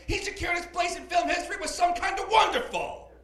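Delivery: loud, worked-up scolding rant; hammed-up outrage, building to a high finish; mock angry tirade. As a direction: berate a child as an offended Santa. Acted Santa tirade at the child.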